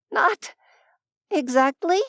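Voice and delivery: barely holding in a giggle; suppressed laughter. Acted. Choking back hysterical laughter.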